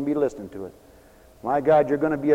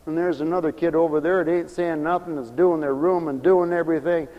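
normal talking voice

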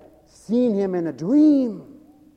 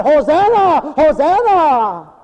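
high, loud, drawn-out hosanna; crowd shouting hosanna; 10 semitones higher, 9 dB louder, slower than usual. Retold or imitated. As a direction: cry hosanna like a joyful crowd. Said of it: "and shouted".

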